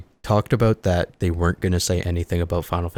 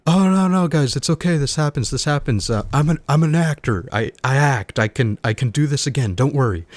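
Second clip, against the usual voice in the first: much higher and louder, eager reassurance; reassuring Terry Crews; 8 semitones higher, 4 dB louder than usual. Retold or imitated. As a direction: brush off the failed take cheerfully, over the top. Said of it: "he's like".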